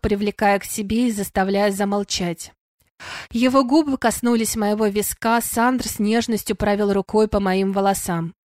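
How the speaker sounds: usual speaking voice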